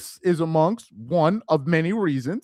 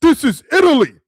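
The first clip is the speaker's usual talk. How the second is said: loud, high, indignant "This is Italy"; angry Italian friend; 9 semitones higher, 9 dB louder than usual. Retold or imitated. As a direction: bellow it as the offended Italian. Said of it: "That's how he said it".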